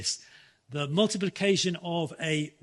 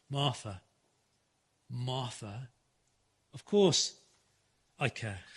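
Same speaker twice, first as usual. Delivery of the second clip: tender reassurance; 5 semitones lower, 5 dB quieter than usual. Retold or imitated. Jesus answering Martha, tenderly.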